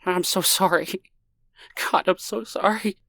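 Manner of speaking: high, tearful sobbing sorry; sobbing apology. A narrator's reading. Stranger breaking down before Lonnie, sobbing. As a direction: sob it out. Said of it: "bent his head and sobbed".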